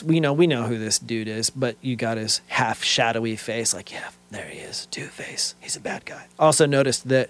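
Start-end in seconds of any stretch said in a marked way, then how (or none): 3.7-6.3 hushed, knowing whisper; low-volume whispered mock reveal; conspiratorial whisper. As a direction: whisper it like a secret aside. Voicing a knowing aside on panel.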